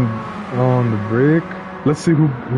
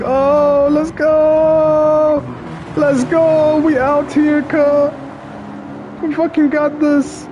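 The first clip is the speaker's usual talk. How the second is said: shrieking jackpot winner; slower than usual. Real, not acted. Celebrating race win on replay.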